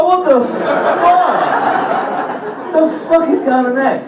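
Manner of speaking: drawn-out incredulous what; slow amazed what the fuck; stunned disbelief. Retold or imitated. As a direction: stretch out the shock. Disbelief at couple.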